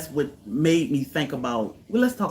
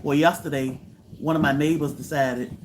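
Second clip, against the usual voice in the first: ordinary talk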